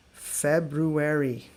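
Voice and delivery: very slow February, all four syllables; slow model word. Shown as a demonstration. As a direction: stretch February out fully. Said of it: "many Americans even mispronounce".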